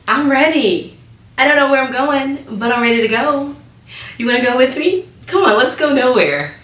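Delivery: bubbly high pretend voice; playful high voice. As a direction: joke in a bouncy high voice. Joking, pitched-up playful voice.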